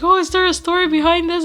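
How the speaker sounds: high, bright mock question; high-pitched mock curious asker; imitating curious fan. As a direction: mimic someone asking eagerly. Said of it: "Someone actually asked, like".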